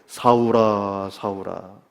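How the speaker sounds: low, slow, drawn-out calling; gentle admonishing. Acted. Jesus appears and calls Saul twice, gentle admonishing. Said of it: "뭔가 부드럽게 타이르는 겁니다".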